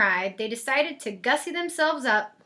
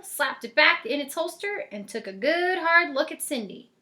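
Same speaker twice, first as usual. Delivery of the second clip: ordinary delivery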